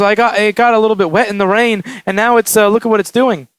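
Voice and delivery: frantic. Retold or imitated. Customer explaining her wet phone to the repairer, mock frantic. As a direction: imitate a flustered customer explaining herself. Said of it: "she's really frantic".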